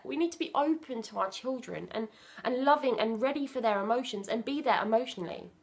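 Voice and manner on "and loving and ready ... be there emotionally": ordinary talk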